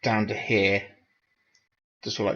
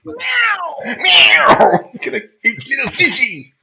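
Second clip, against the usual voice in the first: loud, very high yowling cat voice; Cat from Red Dwarf; 9 dB louder, much slower than usual. Acted. Player spoofs the cat for friends, loud yowling impression. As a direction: yowl and meow in a hammed-up squeal. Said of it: "sound like the cat from Red Dwarf".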